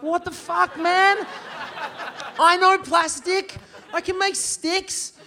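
high, exasperated comic protest; high-pitched incredulous whine; mock outraged disbelief. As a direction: stretch out the last words in disbelief. Disbelief at how someone got rich.

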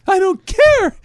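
put-on very high and loud, a wailing kid begging his mom; whiny crying child Messi. Messi wailing to his mom after missing a penalty, very high and loud. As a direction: whine it up high like a sobbing little boy. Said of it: "The best is the mom".

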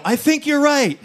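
very high, loud comic concession; hammed-up high-pitched holler; panicked mock yell. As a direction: shout agreement as if still arguing. Yells agreement at height of argument.

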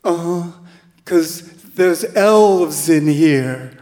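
put-on loud, slow, spaced-out girl's voice; tripping teen girl. Tripping girl telling the volunteer about elves, loud and slow. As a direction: drag it out like she's seeing elves. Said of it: "And she said".

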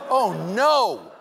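loud fake panic; hammed-up gasp of dismay; mock alarm.

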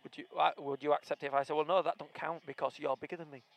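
usual speaking voice

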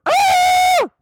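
a long, high, loud hammed-up scream; mock scream of pain. Swimmer in piranha video screaming, hammed-up pain scream. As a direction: scream like fish are biting you.

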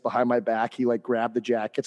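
ordinary delivery

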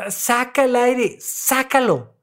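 ordinary talk, nothing marked

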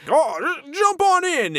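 very high, cheery invitation; eager driver. Retold or imitated. Driver inviting them aboard, very high eager voice. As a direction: squeak it like an overeager stranger. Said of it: "And he's like".

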